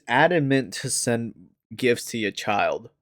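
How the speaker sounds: usual speaking voice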